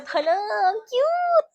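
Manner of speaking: high, slow squeal of delight; delighted gush. Real, not acted. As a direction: gush over the gift. Delighted by wallet gift.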